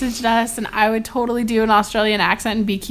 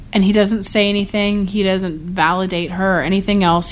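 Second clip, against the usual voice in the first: ordinary talk, nothing marked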